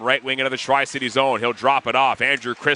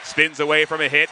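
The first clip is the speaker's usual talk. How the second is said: ordinary talk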